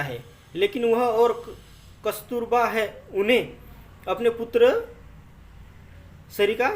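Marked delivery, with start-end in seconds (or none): none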